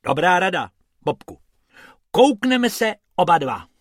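agreeing brightly; cheerful rabbit. Acted. Bob praises Bobek's advice, high and cheerful.

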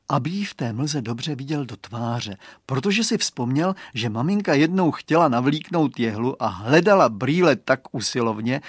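plain reading voice

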